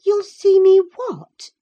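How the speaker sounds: put-on very high, loud, incredulous girl; surprised. Girl answers the lad's curse, loudly surprised. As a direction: shoot up high in disbelief. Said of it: "asked Catherine in surprise".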